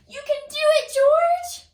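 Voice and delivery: high, loud cheering shout; excited cheer. Acted. Reader cheering George on at the restaurant, loud and high. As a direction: cheer him on with everything.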